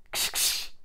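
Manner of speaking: sharp voiceless shoo; shooing hiss. A narrator's reading. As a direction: hiss twice to drive them off. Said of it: "rief die Baumfrau und fuchtelte". (scheuchendes Zischen)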